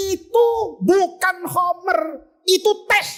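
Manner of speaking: high, drawn-out declaration; solemn proclamation. Acted. Tabrizi declaring the bottle is tea, slow high proclamation. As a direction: pronounce it slowly as holy truth.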